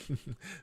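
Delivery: soft chuckle breaking into the reading; stifled laugh. Real, not acted. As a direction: laugh quietly, trying to hold it in. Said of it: "get through this sentence without laughing".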